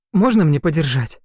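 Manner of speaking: excited. Acted. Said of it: "Кэрол запищала от восторга".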